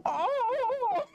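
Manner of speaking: very high, far-off seagull cries; seagull impression. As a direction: cry out like a gull over the beach. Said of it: "Seagull impression?".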